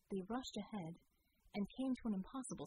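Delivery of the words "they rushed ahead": usual speaking voice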